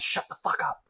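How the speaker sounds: urgent whispered shush; retold hushed command. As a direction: whisper it as if covering someone's mouth. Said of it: "covers his mouth and says".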